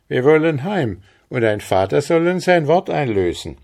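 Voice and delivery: höher und lauter, freudig erregt; begeistert. Acted. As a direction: voller Freude drängend. Andres, excited about the rain. (Andres erzählt begeistert, höher und lauter)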